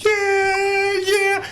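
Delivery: very high, stretched-out yeah; imitated rock yell. Retelling the wrestler singing along backstage, high drawn-out yell. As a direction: holler it like a rock singer.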